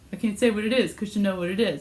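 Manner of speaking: usual speaking voice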